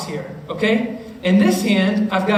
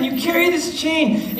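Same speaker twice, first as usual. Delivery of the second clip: usual speaking voice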